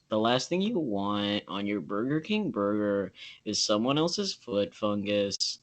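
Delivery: slow, soft deadpan narrator read; Chills impression. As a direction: draw out someone else's foot fungus slowly and quietly. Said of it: "really good Chills impression".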